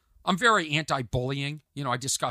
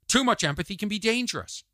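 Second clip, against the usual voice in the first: usual speaking voice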